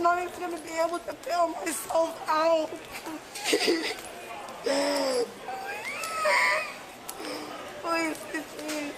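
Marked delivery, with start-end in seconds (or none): 2.8-7.8 wailing sob cry. Real, not acted.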